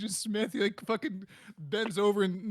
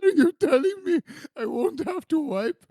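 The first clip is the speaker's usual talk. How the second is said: higher, giggly mock Indian accent; 6 semitones higher than usual. Comic asking Morpheus as Neo, giggly Indian accent. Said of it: "I believe in your Indian accent".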